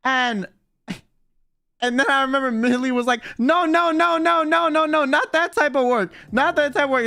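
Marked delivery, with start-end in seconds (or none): 3.4-7.1 imitated high, rapid-fire protest; mock-alarmed string of denials; frantic denial. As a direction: hurry to correct a misunderstanding, pitch way up. Retold frantic denial to driver.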